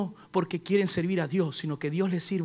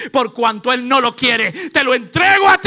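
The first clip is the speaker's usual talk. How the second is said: slow, emphatic proclamation climbing to a high shout; acting out a preacher thundering over someone; preacher's prophetic declaration; 5 semitones higher, 12 dB louder than usual. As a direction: build from loud decree to a soaring yell. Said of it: "siento la mano del predicador que me la pone en la cabeza y dice".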